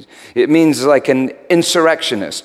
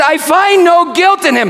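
loud declaration; 14 semitones higher, 4 dB louder than usual. Acted. Pilate declaring to the crowd.

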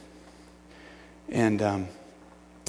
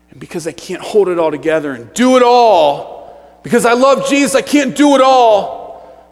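frantic striving; 8 semitones higher, 13 dB louder than usual. Acted. Preacher acting out his own overload, frantic shouting. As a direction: build to a desperate full-voiced shout.

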